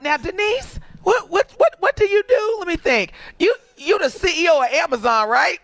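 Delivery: high-pitched, pushy relative fishing for gossip; nosy relative impression. Relative grilling Denise about her job at Thanksgiving, high and pushy. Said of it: "this is the one I love".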